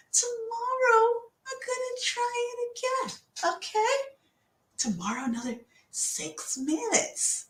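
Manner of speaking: slow, very high little voice. Retold or imitated. Coach as their gentle inner voice planning tomorrow, high and slow. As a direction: promise it slowly in a high, babying voice. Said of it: "listen to that voice a little bit".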